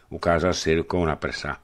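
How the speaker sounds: plain reading voice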